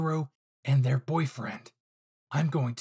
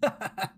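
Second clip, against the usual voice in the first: villain laugh; 7 semitones higher than usual. Acted. Villain laughs at captured heroes.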